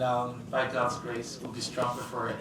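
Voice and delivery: usual speaking voice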